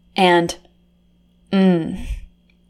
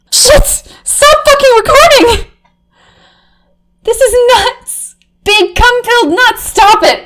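shrill outburst; 14 semitones higher, 5 dB louder than usual. Acted.

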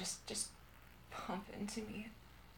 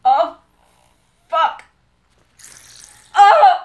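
very loud, high cries; full-voiced, climactic yell; ecstatic shouting; 7 semitones higher, 22 dB louder, much slower than usual. Real, not acted. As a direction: cry out uncontrollably. Intimate climax.

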